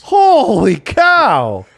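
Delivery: surprised. Real, not acted. Amazed at concert news.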